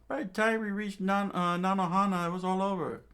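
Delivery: high-pitched vassal voice; vassal's report. Acted. Vassals kneel reporting to the king, high vassal voice.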